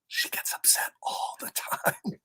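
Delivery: whispered aside; teasing whisper. Real, not acted. Teasing about Miss Simone, a conspiratorial whisper. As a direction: whisper it like a secret about a coworker.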